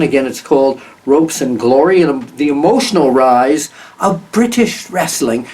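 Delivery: a put-on British accent, lightly done. Shown as a demonstration. Host repeats the book title to viewers in a playful British accent. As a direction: Ropes and Glory read out in a mock British accent.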